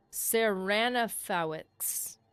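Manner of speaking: Seranafowicz sounded out slowly as se-RAN-a-FOW-itz; slow careful name. Shown as a demonstration. Streamer sounding out an actor's name, slow and careful. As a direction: spell the name out syllable by syllable. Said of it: "butchered the Slavic spelling".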